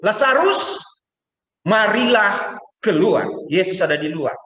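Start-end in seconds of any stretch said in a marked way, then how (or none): 0.1-3.3 high, loud, slow summons; calling Lazarus. Acted. Jesus calling Lazarus out of the tomb, loud and slow. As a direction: call him out of the tomb.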